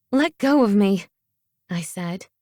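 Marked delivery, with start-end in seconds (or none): 0.1-1.1 higher, louder demand; raised, urgent voice; protesting. A narrator's reading. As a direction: insist on being released. Grabbed, tries to get free.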